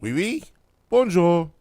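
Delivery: put-on French accent, rising on the first words. Shown as a demonstration. Co-host asked to do French, put-on French accent. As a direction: try out a light French accent. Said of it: "can you do a French accent?".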